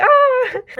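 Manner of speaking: high-pitched happy squeal; excited squeal. Retold or imitated. Reenacting waking up to the video's support, excited squeal. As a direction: let out a reenacted giddy "ah". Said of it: "woke up all happy and excited".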